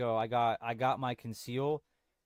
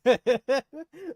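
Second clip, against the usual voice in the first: loud laugh; 12 semitones higher, 10 dB louder than usual. Real, not acted. Laughing at own impression.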